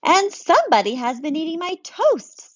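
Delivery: put-on a kid wailing over lost toast; high child's complaint; upset child. Baby voiced in read-aloud story.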